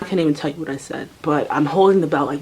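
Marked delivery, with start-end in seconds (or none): none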